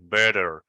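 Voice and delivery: "better" with a quick tapped d; American flap in better. Shown as a demonstration. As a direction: say "better" as "bedder", fast tongue tap. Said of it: "Like in better in American pronunciation".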